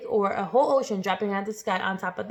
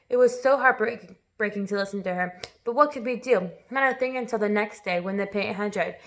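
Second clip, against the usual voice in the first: plain reading voice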